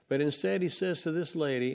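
ordinary talk, nothing marked

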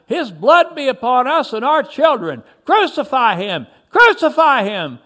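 high, loud mob fury, narrated; angry shout. A narrator's reading. Another in the swelling crowd, angry shouting. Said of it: "Another shouted".

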